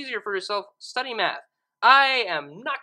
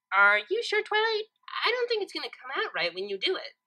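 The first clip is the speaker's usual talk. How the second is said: high doubtful pony, quick at the end; high pony voice doubting her friend; unsure Pinkie; 8 semitones higher than usual. A narrator's reading. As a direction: question it lightly then hurry on.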